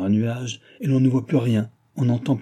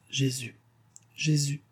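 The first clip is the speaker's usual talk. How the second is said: soft, higher broken plea; sobbing mother; 5 semitones higher, 6 dB quieter than usual. A narrator's reading. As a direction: whisper the name through a sob. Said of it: "en sanglotant".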